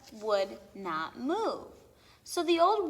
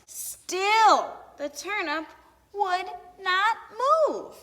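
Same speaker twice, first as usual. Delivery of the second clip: exasperated climax; 8 semitones higher, 7 dB louder, slower than usual. A narrator's reading. Climax of failed pull.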